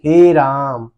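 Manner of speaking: हे राम with हे and रा held long; drawn-out vowels. Shown as a demonstration. Teacher gives an everyday example, हे राम loud and prolonged. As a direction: stretch हे राम out loudly. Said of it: "ज्यादा समय लग रहा है".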